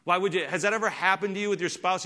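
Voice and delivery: ordinary delivery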